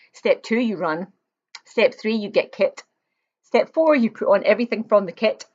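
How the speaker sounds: ordinary delivery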